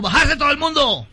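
high barked command; guerrilla order. Acted. Guerrilla to bus passengers, loud high order.

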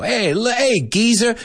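high-pitched mock British "hey, geezer"; British street call. Teacher imitating Brits hailing an old man, British call. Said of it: "The British use it pretty much".

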